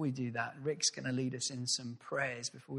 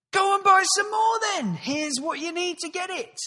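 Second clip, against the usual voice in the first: very high, loud shouted lord; the Earl; 10 dB louder than usual. Acted. The Earl shouting at his butler to buy more, high and loud. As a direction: order it airily like a grand lord.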